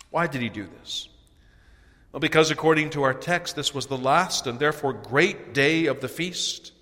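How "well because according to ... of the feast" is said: ordinary talk, nothing marked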